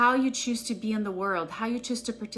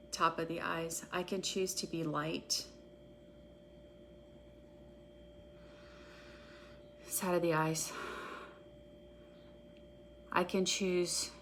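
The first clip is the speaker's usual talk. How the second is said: a guided EFT tapping script read for followers; guided tapping meditation; 3 semitones lower, 9 dB quieter than usual. Acted. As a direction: call each tapping point, then the affirmation, at an even unhurried pace. Leads a guided tapping session.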